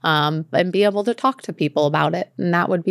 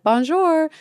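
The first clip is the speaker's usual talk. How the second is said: Bonjour sung out high and cheery; eager tourist saying Bonjour; high bright French greeting; 11 semitones higher than usual. Retold or imitated. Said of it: "if you try to be like".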